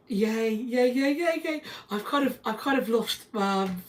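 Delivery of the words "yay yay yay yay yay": excited yays. Real, not acted. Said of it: "I'm really excited".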